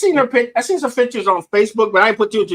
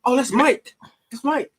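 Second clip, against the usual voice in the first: starstruck fan; 6 semitones higher than usual. Retold or imitated. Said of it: "like he's the Beatles".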